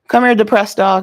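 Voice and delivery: loud call to the dog. Real, not acted. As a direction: call the dog over loudly. Calling the dog across room.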